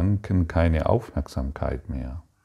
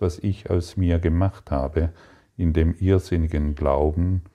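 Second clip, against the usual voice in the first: normal talking voice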